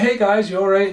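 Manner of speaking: high, loud hey guys; mock-cheery call to the neighbours; fake friendly. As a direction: greet them with put-on cheer. Confronting the neighbours.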